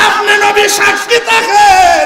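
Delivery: high, slow shouted chant; impassioned cry. Retold or imitated. Mother asking the Prophet to bear witness, high drawn-out cry. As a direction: cry it out, stretching the last word. Same words cried out.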